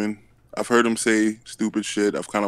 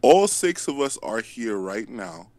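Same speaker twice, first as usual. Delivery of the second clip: ordinary talk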